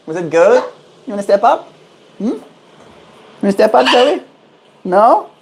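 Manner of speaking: high coaxing pet talk; talking to a pet bird. Real, not acted. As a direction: coax the bird onto your hand.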